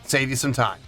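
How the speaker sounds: ordinary talk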